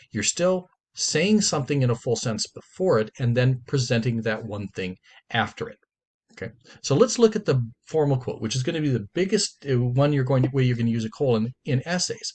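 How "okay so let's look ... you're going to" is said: ordinary talk, nothing marked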